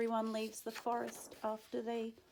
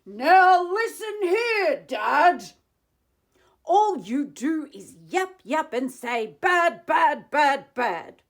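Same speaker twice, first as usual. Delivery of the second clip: loud, higher furious yell; 7 semitones higher, 14 dB louder than usual. Acted. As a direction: shout him down. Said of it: "I yelled at the Lorax".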